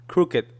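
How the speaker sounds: "Crooked" ending in a light tongue-tap T, like "crookit"; subtle final T. Shown as a demonstration. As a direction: let the tongue just touch the palate ending "Crooked". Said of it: "let my tongue just touch the".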